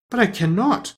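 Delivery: put-on high-pitched protest of a surprised girl; greatly surprised. A narrator's reading. Dorothy balks at Oz's demand, high and surprised. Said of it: "greatly surprised".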